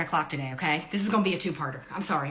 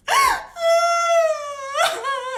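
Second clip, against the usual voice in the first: high laughing cackle; 6 dB louder than usual. Real, not acted. Laughing at coworker's story.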